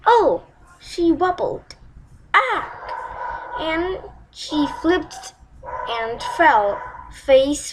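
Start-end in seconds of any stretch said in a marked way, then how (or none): 2.3-3.6 sharp breathy gasp of alarm; startled cry. Acted. Princess slipping by the pond, startled breathy cry. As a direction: gasp as she loses her balance.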